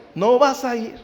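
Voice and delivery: mock stern father raising his voice; firmer stern father. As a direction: repeat the no harder, a father putting his foot down.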